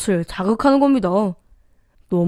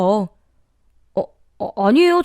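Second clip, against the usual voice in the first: flustered stammer. A narrator's reading. Son-in-law answers mother-in-law's unexpected apology, stammering. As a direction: stumble on the first sound before protesting. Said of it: "말을 더듬거리다가".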